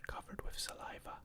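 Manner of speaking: ordinary delivery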